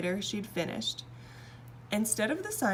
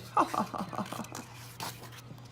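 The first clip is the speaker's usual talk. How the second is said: laugh; 2 semitones higher, 4 dB quieter than usual. Acted. King laughs happily.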